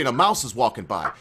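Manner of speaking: usual speaking voice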